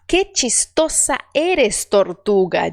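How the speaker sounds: slow, clear Spanish with a closed "Que"; slow model sentence. Shown as a demonstration. Teacher models the sentence again. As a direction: read it slowly, e kept closed. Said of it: "você tem que falar agora".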